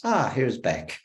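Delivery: normal talking voice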